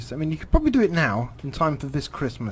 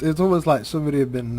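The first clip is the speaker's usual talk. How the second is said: ordinary talk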